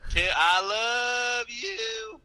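hammed-up Southern drawl, high and stretched. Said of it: "this is so much worse".

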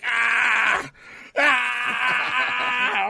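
high shouted cries of pain; imitated pained cry. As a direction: cry out trapped in the hurt lock. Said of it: "you just see Xavier Woods like".

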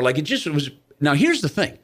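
ordinary delivery

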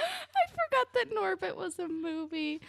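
talking through real laughter, voice high; laughing. Real, not acted. Co-host cracks up over forgotten Norbit movie, laughing. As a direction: squeeze the words out while laughing.

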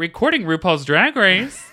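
loud, slow, knowing reply; sassy phone assistant recording the wrong show. Pretend. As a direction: deliberately get it wrong.

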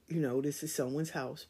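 usual speaking voice

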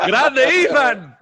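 loud, high cheer; a real whoop of approval; cheering shout. Real, not acted. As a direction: cheer him on like a fan. Cheering the son's tattoo idea.

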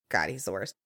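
normal talking voice

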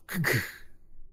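unvoiced mouth-made grinding noise; imitated grinding halt. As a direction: grind to a stop with your mouth.

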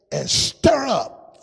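rising preacher's cry; preaching swell. Acted. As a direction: lift the church with it. Preaching climax.

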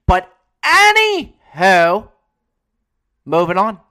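booming, drawn-out announcer shout; loud comic transition. Acted. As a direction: bellow it to wrap up the topic. Abrupt comic topic change.

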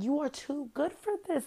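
high-pitched plea without real volume; exasperated mock yelling in a high voice; mock screaming. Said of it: "now I'm screaming at Atlanta ladies like".

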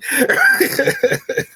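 real high-pitched laugh. Real, not acted. Guest telling interviewer how the story ends, high laughing. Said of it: "I mean, I'm laughing".

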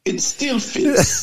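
high, slow, hammed-up quote; slow high mock quote of the movie line; mock Red Guardian line. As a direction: drag it out like a proud big oaf. Repeats the quote in his voice.

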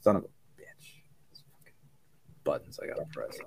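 whispered curse under breath, then barely audible; hushed annoyed muttering; muttered to himself. Real, not acted. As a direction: grumble it under your breath at the buttons. Fumbling with buttons, muttering.

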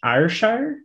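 a tentative, rising Scottish "Ayrshire?"; unsure try at a Scottish "Ayrshire?", lifting at the end; Scottish accent attempt. Shown as a demonstration. While looking up the castle, testing a Scottish "Ayrshire?". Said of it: "I'm trying to say it with a Scottish accent".

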